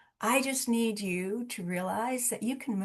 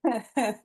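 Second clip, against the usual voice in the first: short real laugh at her own joke; bright laugh. Real, not acted. Host laughing at her own joke about ending the call, real laugh. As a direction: laugh it off after the punchline.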